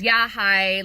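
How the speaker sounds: lightly voiced unfriendly greeting; not very friendly. Client greeting photographer on phone consult, not very friendly. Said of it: "she wasn't really f-, very friendly".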